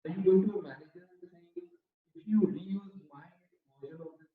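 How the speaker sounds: barely audible. Real, not acted. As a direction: voice fading away from the microphone. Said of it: "Your voice is very low".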